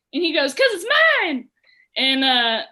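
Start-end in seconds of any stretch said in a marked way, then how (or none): 0.6-1.5 possessive little-brother whine, high and loud; Billy. Retold or imitated. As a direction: push it up high like a protesting kid. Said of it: "in his Billy voice".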